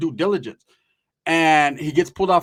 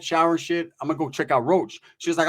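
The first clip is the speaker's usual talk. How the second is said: normal talking voice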